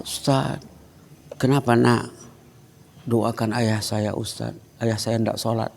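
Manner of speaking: put-on a soft, hushed retelling of a crying child; mild tearful child voice. Teacher retells the weeping first-grader asking prayers for his father, hushed and tearful. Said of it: "Anak itu datang menangis".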